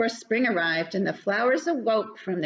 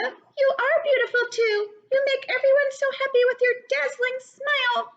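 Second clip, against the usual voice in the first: very high, fast twittering bird voice; chirpy bird; 14 semitones higher, 3 dB louder than usual. Acted. Bella Bird offering Lucy a spot, very high and fast.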